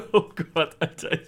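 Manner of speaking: high, loud laughter; laughing. Real, not acted. Laughing at embarrassing topic.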